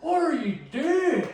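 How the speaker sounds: high, slow mock scold with a laugh; imitating the teacher. Teacher scolding Roy in the hallway. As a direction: stretch the name out, half laughing. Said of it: "was like".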